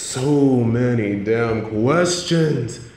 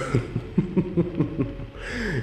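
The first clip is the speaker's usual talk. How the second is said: a light breathy chuckle that falls away; soft villain laugh; 6 semitones higher, 7 dB quieter than usual. Real, not acted. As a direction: laugh softly at the reporter's remark. Villain amused by reporter's joke.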